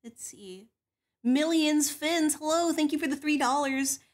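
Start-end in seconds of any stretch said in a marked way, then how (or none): 0.0-0.7 whisper. Real, not acted. Whispered aside.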